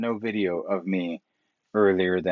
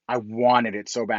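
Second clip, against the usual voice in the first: ordinary talk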